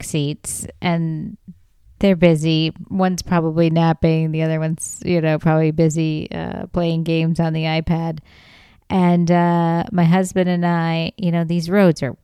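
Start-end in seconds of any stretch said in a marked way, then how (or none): none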